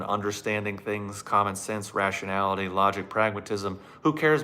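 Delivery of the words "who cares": sarcastic scoff. Real, not acted. Sarcastic outburst.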